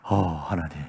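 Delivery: low, soft murmur of relief; softly acted sigh to oneself; retold mutter. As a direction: mumble it absently under your breath. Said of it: "중얼거렸습니다".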